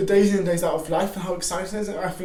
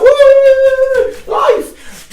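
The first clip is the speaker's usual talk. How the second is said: much higher, loud joyful shout; mock whoop; 15 dB louder than usual. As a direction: cheer like life is a party. Distracted person cheering.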